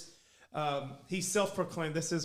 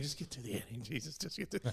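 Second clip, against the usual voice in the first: much softer, pleading under the breath; muttered prayer; 3 semitones lower, 9 dB quieter, faster than usual. Retold or imitated. Fans praying the hungover pitcher gets through, very soft. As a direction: quietly beg it to end. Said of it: "now we're praying".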